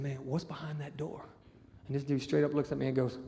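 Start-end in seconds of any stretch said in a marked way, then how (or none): 0.0-1.2 low, very soft wary question; acted secretive murmur; hushed question. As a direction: ask it confidentially under your breath.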